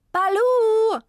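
loud high call across the garden. Acted. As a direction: call the dog from afar. Woman calls her dog.

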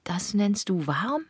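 high, slow, breathless whispered disbelief; a horrified, rising question; incredulous. Acted. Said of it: "Ungläubig erschrocken".

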